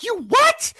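very high-pitched, indignant outburst; annoyed disbelief. Retold or imitated. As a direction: imitate her pissed-off astonishment. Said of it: "she's so annoyed".

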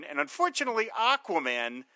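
ordinary talk, nothing marked

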